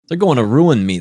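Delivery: loud, high angry burst; furious outburst. Acted. Phelps raging into the phone at the caller's news. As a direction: spit it out in rage.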